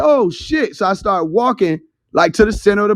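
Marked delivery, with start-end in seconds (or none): 0.0-0.8 high-pitched startled realization; retold amazement. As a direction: re-enact a stunned discovery, pitch up. Retelling his shock at standing on water.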